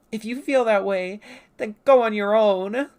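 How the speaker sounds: loud, high indignant retort; acted huffy retort. Murgo telling Penta to leave, huffy retort. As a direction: fire back hurt and loud.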